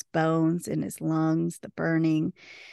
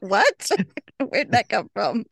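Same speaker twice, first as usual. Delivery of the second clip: surprised what; 3 semitones higher, 4 dB louder than usual. Retold or imitated. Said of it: "I was like".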